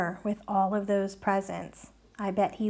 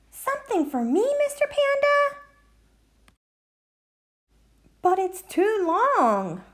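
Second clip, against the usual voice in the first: high goat voice; 11 semitones higher than usual. Acted. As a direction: squeak it out like a little goat. Voicing Mountain Goat.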